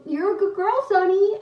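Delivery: high, teasing pet talk; dog-praising voice. Retold or imitated. Sisters praising the dog to annoy mom, teasing pet talk.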